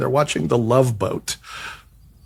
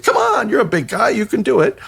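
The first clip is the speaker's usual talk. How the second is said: higher, teasing push to go on; goading agent; 8 semitones higher than usual. Retold or imitated. Agent teasing the big guy into kicking, mock-encouraging. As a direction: egg him on with a grin.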